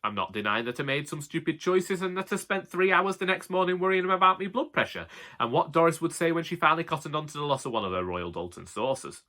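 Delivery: ordinary delivery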